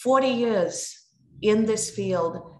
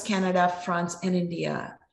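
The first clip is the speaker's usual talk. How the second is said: normal talking voice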